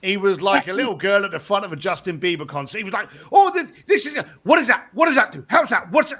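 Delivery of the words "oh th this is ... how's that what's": high, loud, rushed fanboy questions; overexcited fan. Retold or imitated. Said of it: "too excitable".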